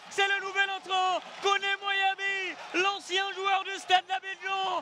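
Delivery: excited sports commentary. Real, not acted. Goal call by commentator.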